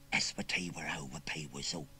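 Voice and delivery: secret whispered incantation; whispered spell. Acted. Boy whispers a spell to wake the scarecrow. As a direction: hiss out a magic charm.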